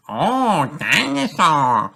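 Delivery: put-on high, slow, wondering little boy; George in awe. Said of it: "says George".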